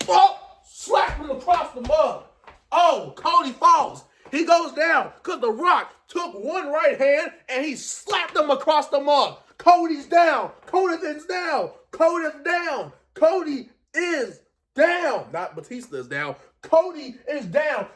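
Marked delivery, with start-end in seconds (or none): 0.1-15.3 high, drawn-out ringside call; slow, high-pitched cries of disbelief; excited play-by-play. Retold or imitated. Re-enacts the slap and fall. 16.6-17.9 high, drawn-out ringside call; slow, high-pitched cries of disbelief; excited play-by-play. Retold or imitated. Repeats the knockdown call.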